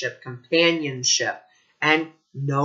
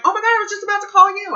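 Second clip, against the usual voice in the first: much higher and louder, very fast; excited friend; 7 dB louder, much faster than usual. Retold or imitated. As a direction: burst out in delighted surprise. Friend surprised by the call.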